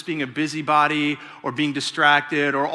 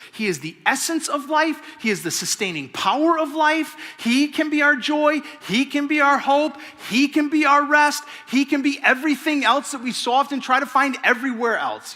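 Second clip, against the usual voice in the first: higher, building proclamation; rising preaching; 9 semitones higher than usual. Real, not acted. Preacher stirring the congregation, building force. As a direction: lift it up, proclaim each line.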